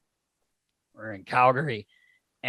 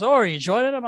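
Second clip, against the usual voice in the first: lightly voiced waitress, higher and louder; bright waitress voice; 10 semitones higher, 5 dB louder, faster than usual. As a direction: play the cheerful server greeting him. Waitress voiced in bar story.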